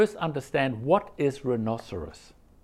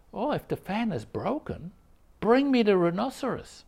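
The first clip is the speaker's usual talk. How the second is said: higher, teasing challenge; challenging; 6 semitones higher than usual. Retold or imitated. Master challenging his attendant, teasing. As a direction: needle him with it.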